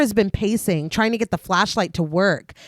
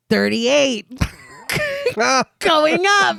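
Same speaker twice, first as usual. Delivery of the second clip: high croaking gremlin voice; 6 semitones higher, slower than usual. A narrator's reading. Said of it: "a gremlin's croak".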